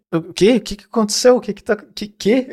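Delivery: high, bewildered mock questioning; confusão encenada. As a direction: act out not understanding a caller, pitch climbing. Imita a si mesmo sem entender. (confuso e perdido, imitação aguda)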